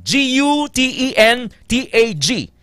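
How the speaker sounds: ordinary delivery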